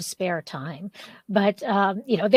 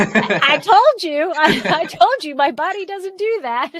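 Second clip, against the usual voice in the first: laughing through the words; 6 semitones higher, 4 dB louder than usual. Real, not acted. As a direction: chuckle it out wryly. Laughing at own injury story.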